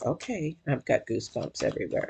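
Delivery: very soft, low reaction; hushed aside. Retold or imitated. Reader telling viewers of their own reaction, hushed aside. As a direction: drop right down, as if to yourself.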